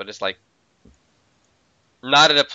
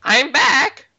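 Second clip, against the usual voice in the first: excited call; 11 semitones higher, 4 dB louder than usual. Real, not acted. Rejoins game, calls out.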